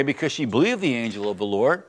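usual speaking voice

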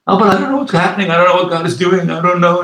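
high, fast, flustered worrying; mock panicky fretting. Preacher mimics a worried churchgoer's rushed, high fretting. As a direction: rattle it off like a fretful believer who can't see God's plan.

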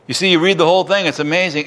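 normal talking voice

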